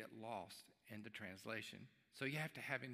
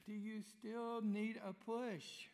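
calling out; 10 semitones higher, slower than usual. Retold or imitated. Husband calling in the rain.

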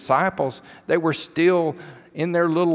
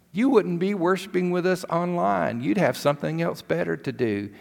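ordinary talk, nothing marked

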